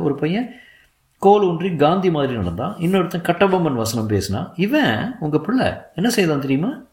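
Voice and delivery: high-pitched teacher's complaint; aggrieved lady teacher. A narrator's reading. Teacher complaining to a pupil's father, aggrieved voice. Teacher reports the boy's behaviour.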